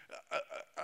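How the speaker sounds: unvoiced nervous stammer, uh uh uh; stuttering. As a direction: stammer helplessly like frightened Moses. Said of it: "he stuttered".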